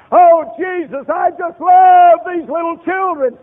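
loud, high, slow shouted devotion; mock gushing worker. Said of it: "Jesus didn't say".

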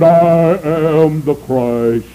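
slow, drawn-out monotone intoning. Retold or imitated. False Christ announcing himself to the crowd, slow monotone. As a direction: intone it flat and slow like a fake messiah. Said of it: "a fellow came out with a monotone voice".

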